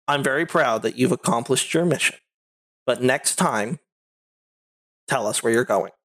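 low, restrained mom voice; measured reproof, pausing between phrases; stern mother. Acted. Mother answers child's long excuse.

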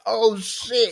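high-pitched shocked exclamation, acted; hammed-up astonishment; mock shock. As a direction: play the big reaction that never came. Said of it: "it wasn't like".

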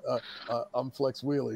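soft, stammered answer; small, hesitant voice; meek introduction. Retold or imitated. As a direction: imitate a rookie timidly giving his name. Rookie answering the raging promoter.

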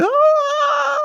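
high, loud mock shriek; mock scream. Kids see the severed hand, mock scream. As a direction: shriek as if in horror.

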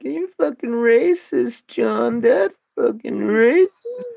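high, slow mocking whine of an accusation; mocking girlfriends. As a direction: drag out the accusation in a high mock voice. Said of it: "are like".

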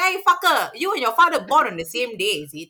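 loud, high, mock-angry rant; exasperated outburst. Staff retelling her outburst at the son faking cards, exasperated. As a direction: raise the voice in hammed-up exasperation.

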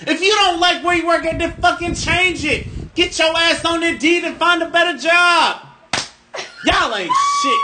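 high, loud furious shout; going off. Real, not acted. Going off on rude food service workers, shouting high and loud. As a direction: yell it at them, fed up and done. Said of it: "I just went off on y'all".

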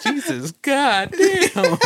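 high, drawn-out reenacted cry through laughter; loud outburst. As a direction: draw the swear out high, half laughing. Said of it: "Loud. It wasn't like".

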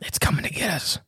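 scared whisper; hushed, fearful whisper; urgent resistance whisper. Pretend. Mock fear of a Bigfoot.

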